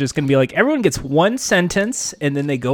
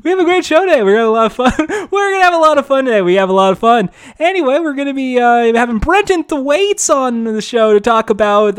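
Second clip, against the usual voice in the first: a much higher, louder, fast over-eager host voice; Chris Hardwick impression; 6 semitones higher, 4 dB louder than usual. Guest mocking the host's cheery patter, hammed-up host voice. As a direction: bounce through it like a manic game-show host. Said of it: "do your Chris Hardwick then".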